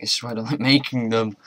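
usual speaking voice

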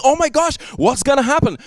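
a playful mock fret, hands on head; mock panic. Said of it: "God's not freaking out with his hands in his head and saying".

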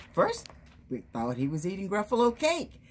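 plain reading voice

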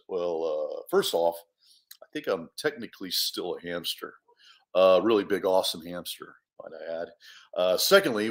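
low, matter-of-fact bear voice; laid-back bear. Acted. Bear explaining events to Louis, laid-back. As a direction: explain it casually, pitched well down.

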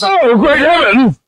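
high, slow, drawn-out gasp of shock; shocked exclamation. Acted. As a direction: clutch your pearls.

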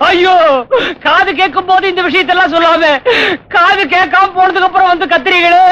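high, wailing reproach; hammed-up funeral lament; mock crying. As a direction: scold the mourners through put-on sobs. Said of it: "ஒவ்வொருத்தரும் அழ ஆரம்பிச்சிருக்கிறாங்க".

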